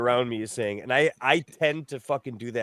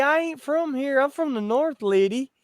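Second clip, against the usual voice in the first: put-on Southern accent; 13 semitones higher than usual. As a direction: imitate a Southern lady asking where you're from. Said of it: "they'd be like".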